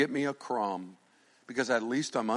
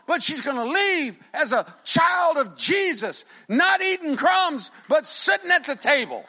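high, loud fervent preaching; rally the congregation to the table; preaching at full force; 12 semitones higher, 6 dB louder than usual. Acted. Pastor urging the congregation to sit at the table, loud high preaching.

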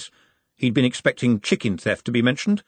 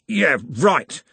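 dismissive grunt, pitched up; grunted reply; 7 semitones higher than usual. A narrator's reading. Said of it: "Andy grunted".

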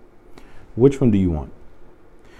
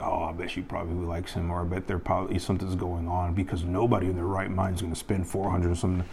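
gossiping listeners; 2 semitones lower, 10 dB quieter than usual. Retold or imitated. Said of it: "people ... be like".

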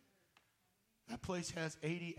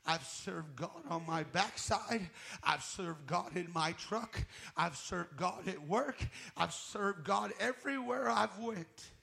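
slow, rising, ever louder litany; building preaching; 7 dB louder than usual. Real, not acted. Preacher listing where he served God, building louder. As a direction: climb with each line.

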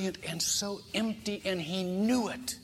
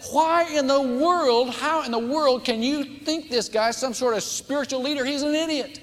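scornful outburst; 10 semitones higher, 5 dB louder than usual. Retold or imitated. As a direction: sneer at the table, much higher and louder. Angry student mocks John at table.

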